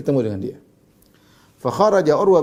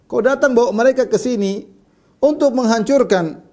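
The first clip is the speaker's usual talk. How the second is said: higher, louder retold reproach; retold harsh rebuke, pitched up; harsh accusation; 9 semitones higher, 5 dB louder than usual. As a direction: confront him in a raised, emphatic voice. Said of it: "Perkataannya keras".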